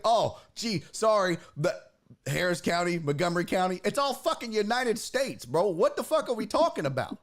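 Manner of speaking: high-pitched exasperated retold rant; worked up and fed up; heated. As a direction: argue in disbelief, pitch climbing. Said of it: "I was getting heated".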